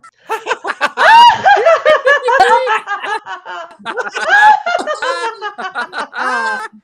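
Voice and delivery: wild hammed-up witch laugh; witch cackle. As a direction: cackle like a Coven witch on cue. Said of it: "Let's cackle".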